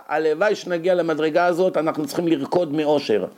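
usual speaking voice

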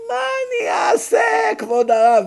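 hammed-up pleading wife; shrieked mock lament to the rabbi; helpless woman. Woman pleading about her shoes.

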